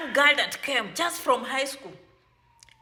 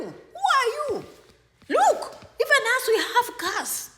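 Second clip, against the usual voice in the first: higher-pitched sneering imitation of a condescending friend; mocking high taunt; 4 semitones higher than usual. Mimicking friends who look down on a newcomer, sneering. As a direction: taunt them from above, pitch raised.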